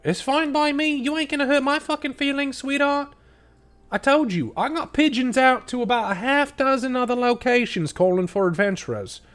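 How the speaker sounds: high, brash foreman woman, teasing; a rough lady pitched well up, swearing and shrugging it off; Blue Nori voice. Acted. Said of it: "She kinda looks down at Payne".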